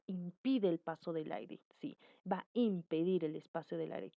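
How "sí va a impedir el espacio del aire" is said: normal talking voice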